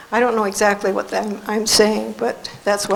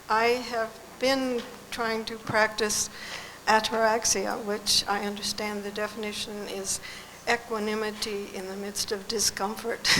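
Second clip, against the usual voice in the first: speaking too quietly; 10 dB quieter than usual. Real, not acted. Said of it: "Can you just speak up a little bit?".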